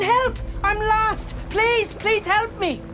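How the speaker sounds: high, pleading cry for help; yelling for help. Retold or imitated. Storyteller reliving being lost in the snow, yelling for help. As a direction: call out desperately, pitched right up.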